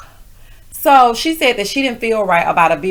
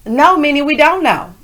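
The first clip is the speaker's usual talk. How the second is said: 1 sassy retort; 6 semitones higher, 4 dB louder than usual. Real, not acted. Sassy rebuttal to Minnie.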